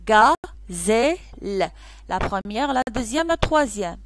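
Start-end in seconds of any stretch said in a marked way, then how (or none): 0.0-1.7 GAZELLE very slow in three beats, ga, zel, le; clapped syllables. Shown as a demonstration. Teacher claps gazelle for pupils, slowly split into syllables. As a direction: break gazelle apart with clear gaps. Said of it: "Frappez-moi le mot gazelle".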